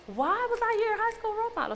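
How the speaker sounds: high, quick questioning tone; retold puzzlement. Asking a former schoolmate why she was admired, puzzled. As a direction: ask it high and fast, puzzled.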